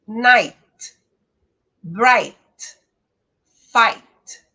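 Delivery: night, bright, fight terminando no T, mais alto; inglês sem vogal final. Shown as a demonstration. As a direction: corte o T seco no fim. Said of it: "olha só". (night, bright, fight sem vogal no fim)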